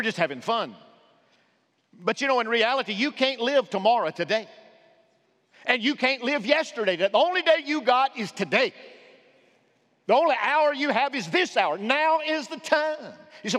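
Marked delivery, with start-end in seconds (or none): 1.9-13.1 loud, high preaching cadence; preaching at full force. Acted. Pastor urging the congregation, loud and high preaching. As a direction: drive it home to the pews.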